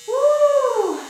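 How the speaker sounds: high, loud celebratory whoop; excited whoop. Real, not acted. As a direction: cheer the class through the last set. Instructor cheering end of set.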